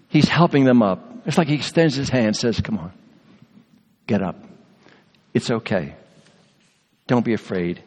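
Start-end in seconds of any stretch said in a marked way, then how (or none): 2.6-7.8 kind. Shown as a demonstration. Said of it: "He spoke it in kindness".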